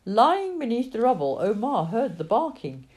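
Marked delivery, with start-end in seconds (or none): none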